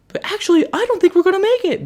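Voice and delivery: put-on very high squeaky kid voice; high-pitched worried kid.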